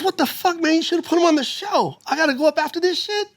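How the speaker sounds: high, indignant mock complaint; Rudy Rush. Host who has to follow the act, high indignant complaint. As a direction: protest in a high, put-on voice. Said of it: "He goes".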